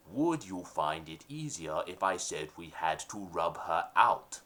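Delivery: low, slow gangster boss voice; mafia boss. Acted. Mob boss ordering his henchman, low and slow. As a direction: growl it like a nineteen thirties mob boss.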